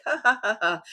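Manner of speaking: laughter welling up like a fountain. Shown as a demonstration. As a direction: let the laugh spill up and over. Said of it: "evolves into fountain laughter".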